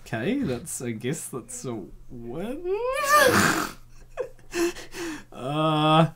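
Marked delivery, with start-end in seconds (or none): 2.8-3.7 very high burst of laughter; high squealing laugh. Real, not acted. Reader squealing with laughter at the line.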